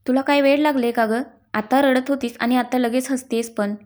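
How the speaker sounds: plain reading voice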